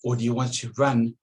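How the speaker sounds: ordinary delivery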